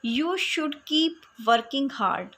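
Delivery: very slow, each word separate; slow example reading. Shown as a demonstration. Teacher reads the example sentence slowly to her students. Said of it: "example समझेंगे".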